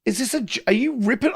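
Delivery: retold high-pitched disbelief; incredulous questioning. Host asking whether the caller was ripping on him, incredulous. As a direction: question it high, as if it's a joke. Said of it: "And I was like".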